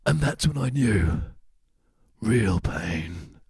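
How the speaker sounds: very slow, with a pause before the last words; hammed-up solemn pronouncement; mock-dramatic. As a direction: draw it out, then drop low on real pain. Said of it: "I'd like you to say again".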